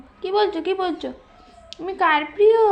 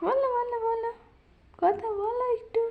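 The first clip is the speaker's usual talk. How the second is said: pleading coax; 5 semitones higher, 7 dB quieter than usual. Real, not acted. Parent urging baby to say something, soft high coaxing. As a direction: beg gently, high and soft.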